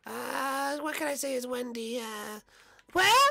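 put-on generic evil girl, very high; squeaky, bratty evil-girl cartoon voice; Wendy. Shown as a demonstration. Wendy calls to Ma, generic evil girl voice. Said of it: "my generic evil girl voice".

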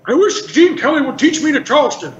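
put-on high, creaky old heckler; cranky old man setting up a joke; Statler. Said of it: "Statler would say".

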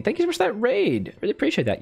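high, bright burst of gratitude; excited thanks. Real, not acted. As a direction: gush your thanks way up high. Thanking a raider on stream.

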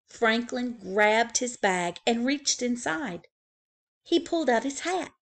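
ordinary delivery, nothing marked

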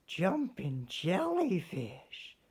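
high, slow little-fish exclamation; startled tiny fish cry; Stanley bumps into a pearl, slow startled cry. Acted. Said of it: "he cried".

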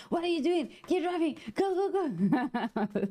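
high-pitched, urgent imitation of panicked friends; mock yelling. Friends yelling at her while she drives, mock yelling. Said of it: "They were, like, yelling at me".

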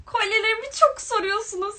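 high, loud, hammed-up declaration; mock announcement. Streamer jokingly announcing to chat, hammed-up high and loud. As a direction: proclaim it like a grand statement, as a joke. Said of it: "Şaka".

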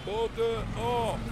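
very slow, every word drawn out; slow and deliberate so he finally gets it; spelled out slowly. Shown as a demonstration. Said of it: "I'll spell it out for you".

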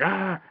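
high-pitched mock walrus cry; wordless cry, like a tongueless walrus-man; imitated walrus grunt. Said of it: "that's all he does is like".

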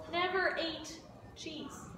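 ordinary talk, nothing marked

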